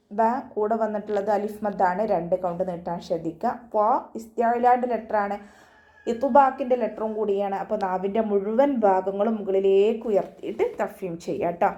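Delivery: ordinary delivery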